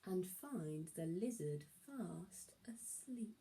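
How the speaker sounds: hushed whisper so as not to wake him. A narrator's reading. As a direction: whisper it like you're sneaking up. Creeping up on sleeping lizard.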